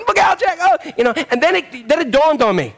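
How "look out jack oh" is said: warning cry. Retold or imitated. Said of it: "I'm like".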